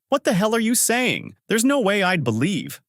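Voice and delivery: indignant outburst, kept at reading volume; a restrained yell of disbelief; mild narrated yell. A narrator's reading. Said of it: "Shiori yells".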